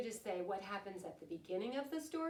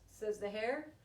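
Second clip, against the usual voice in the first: lively, sing-song picture-book reading, louder than her chat; storytelling to children; 7 dB louder than usual. A narrator's reading. Teacher reading a rhyming bedtime book to her class, lively storytelling. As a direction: read it like story time for little ones.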